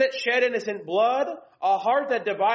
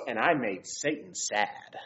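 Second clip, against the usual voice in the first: imitating his little son; 11 semitones lower, 7 dB quieter than usual. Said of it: "And then he goes".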